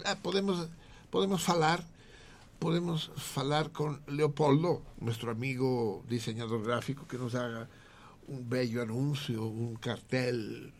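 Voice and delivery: high, loud hammed-up Galician; Gallego voice. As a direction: ham up the Galician with Portuguese falar. Galician character pitching ad.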